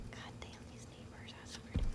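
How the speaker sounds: whispered cursing under the breath; muttered curse. Real, not acted.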